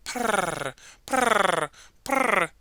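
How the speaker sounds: little rolled purring; kitten purr. Acted.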